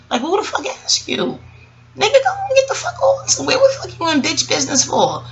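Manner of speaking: high-pitched mock telling-off; exasperated, high-flying scorn; scornful dismissal. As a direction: send someone packing, voice shooting up. Acts out telling off catty man.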